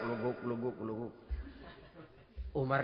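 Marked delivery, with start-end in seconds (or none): 0.0-1.1 soft, low bubbling imitation of a pot boiling; boiling water sound. Preacher imitating a boiling pot for the congregation, soft gurgle. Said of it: "bunyi air ngegulak".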